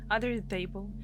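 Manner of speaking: normal talking voice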